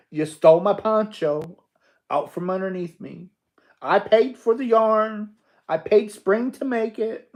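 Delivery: loud, high, playful indignation; hammed-up accusation, pitched high and loud; teasing a friend with mock grievance; mock outrage. Mock complaint about the stolen poncho.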